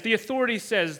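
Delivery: ordinary talk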